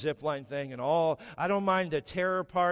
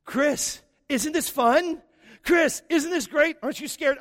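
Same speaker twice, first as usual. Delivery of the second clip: high, loud gushing wife; a wife calling to her husband, delighted; excited wife; 11 semitones higher, 6 dB louder than usual. Retold or imitated. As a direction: play his thrilled wife, cheering him on. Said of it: "is my wife".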